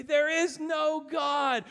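a loud, slow irritated neighbor; irritated atheist. Acted. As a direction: hammer each word at her. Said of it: "he'd get irritated and he'd say".

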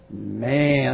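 deep, meaty, chunky "Man"; low manly voice. Shown as a demonstration. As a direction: say it like a soup commercial. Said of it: "you got a low voice and you want to kind of say it with some kind of like Campbell's".

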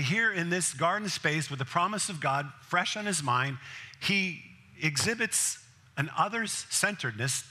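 ordinary talk, nothing marked

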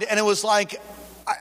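ordinary talk

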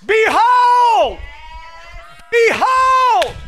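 normal talking voice

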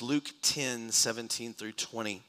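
usual speaking voice

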